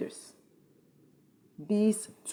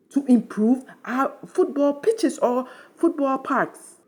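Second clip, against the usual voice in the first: indignant outburst; 6 semitones higher, 3 dB louder than usual. Real, not acted. Commentator calls out Ghana's corrupt leaders, indignant and impassioned. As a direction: denounce them in a high, impassioned rant.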